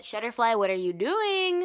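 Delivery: high, loud, slow complaint; exasperated outcry. Real, not acted. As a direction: call out in mock exasperation. Exasperated call to company.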